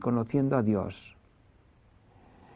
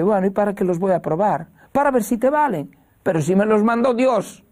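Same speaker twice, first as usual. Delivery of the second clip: put-on protesting child; 8 semitones higher, 5 dB louder than usual. Niño protesta medio dormido.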